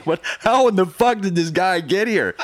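loud, high-pitched disbelief; astonished outburst. Retold or imitated. Station residents seeing him at the window, loudly incredulous. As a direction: exclaim it like a stunned onlooker, half laughing.

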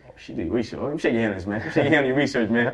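loud, high teasing applause; mock praise. Real, not acted. Teasing the host about her research, loud mock praise. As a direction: hype her up, half laughing.

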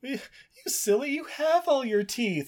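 gentle high put-on scolding; mock mother teasing her son; mom teasing.

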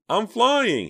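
put-on very high, loud gleeful little boy; happy shout. A narrator's reading. Little brother bouncing on the bed, shouting happily. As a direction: shout for joy mid-bounce. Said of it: "he shouted happily".

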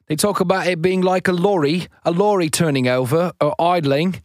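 amateur attempt at a British accent; bad British accent. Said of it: "how bad my accent was".

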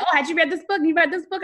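high, eager mimicry of a book-proud client; mock eager client. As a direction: play the keen reader showing off her books. Retelling a client's eager question.

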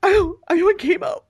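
high, alarmed question; mock alarm. Mocking how people greet a chemo patient, alarmed. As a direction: blurt it out in mock shock.

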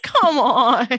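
hammed-up exasperation; mock annoyed whine. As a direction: grumble it like a fed-up sister. Rebecca annoyed at being re-daggered.

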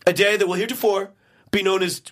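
stuffed-up presidential impression; congested mock-presidential proclamation; Trump with a head cold. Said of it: "my impression of Trump is of Trump being sick with a head cold".

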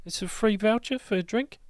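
high, soft, quick bouncer; Bark's voice. Acted. GM as Bark waving them in, high and breezy. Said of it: "he's like".

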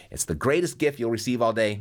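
normal talking voice

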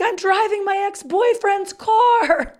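high, slow, loud yell; hammed-up high scream. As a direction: shout it to the whole school. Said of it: "I screamed at them".

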